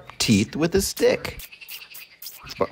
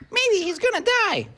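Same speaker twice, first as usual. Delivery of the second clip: very high, birdlike chirp; chirping blackbird. A narrator's reading. Blackbird guessing about the hurt crocodile, high chirp. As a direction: chirp it out like a little bird. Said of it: "chirped a blackbird".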